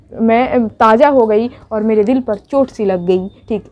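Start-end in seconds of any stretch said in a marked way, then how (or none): none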